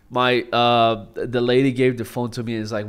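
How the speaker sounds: ordinary talk, nothing marked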